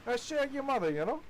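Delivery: mock British accent, pitched high and punchy. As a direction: lay on a cheeky British accent. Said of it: "Crazy how British people walk around like".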